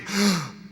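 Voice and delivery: audience's stunned intake of breath; collective gasp. Acted. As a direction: gasp like a whole shocked hall. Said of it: "there's a collective".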